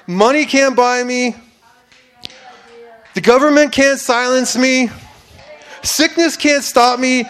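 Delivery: loud, high, impassioned declaration; ringing, defiant proclamation of faith; full-force preaching. Real, not acted. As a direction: preach it with rising fervor, voice lifted.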